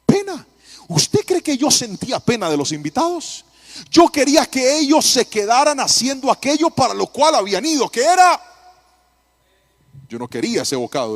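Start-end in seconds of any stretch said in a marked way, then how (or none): 0.0-0.5 scoffing, much higher, loud; mocking echo. Mocking echo of wife's word. 3.9-8.4 louder and higher, pressing the joke; loud emphatic confession. Acted.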